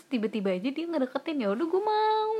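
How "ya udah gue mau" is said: retold eager yes. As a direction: retell it pitched way up, stretching the end. Retelling her eager yes to a suitor.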